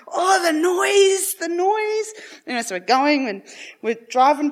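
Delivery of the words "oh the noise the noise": high, slow, wailing dad fed up with the noise; mock suffering dad. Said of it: "Dad's there going".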